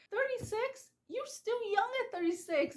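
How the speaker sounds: high, indignant disbelief; mock outraged surprise; incredulous. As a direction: scoff at the very idea. Indignant retold reaction about age.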